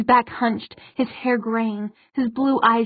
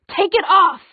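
higher, louder angry cry; furious demand; 8 semitones higher, 7 dB louder than usual. A narrator's reading. Isabel demanding the queen lift the glamour, furious. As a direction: burst out with the order.

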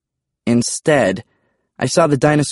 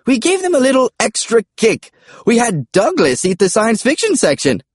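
high comic dinosaur call; high-pitched, pleased dinosaur; dinosaur Charles; 13 semitones higher, 3 dB louder than usual. Acted. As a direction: boast in a high creature voice.

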